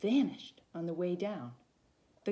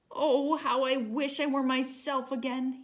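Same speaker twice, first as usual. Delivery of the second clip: longing; 6 semitones higher, 5 dB louder than usual. Acted. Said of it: "he thought".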